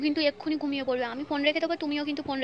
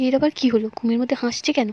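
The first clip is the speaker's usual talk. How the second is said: ordinary delivery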